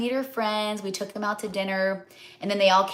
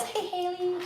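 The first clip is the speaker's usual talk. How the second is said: high chirpy greeting; 12 semitones higher, 7 dB quieter than usual. Retold or imitated. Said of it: "they didn't say it like that".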